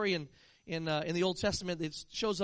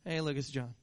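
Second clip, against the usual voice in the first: mild underwhelmed greeting; 7 semitones lower than usual. Pretend. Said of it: "they're gonna go".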